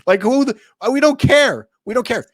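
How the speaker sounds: loud, high scoffing shout; exasperated yell. Retold or imitated. Venting at managers who keep the savings, loud scoffing yell. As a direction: throw it back at the bosses in disgust. Mocking management after union rep story.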